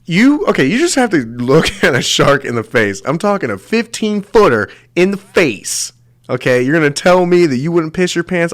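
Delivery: high, loud challenging rant; excited challenge. Real, not acted. Challenging viewers about facing a shark, high and loud. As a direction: press the viewer, voice climbing.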